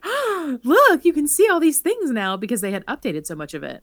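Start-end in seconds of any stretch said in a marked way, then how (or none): 0.0-2.3 high, excited gasp of discovery; imitating their wide-eyed wonder at the ride; amazed delight. Recalling seeing the updated Haunted Mansion, excited amazement.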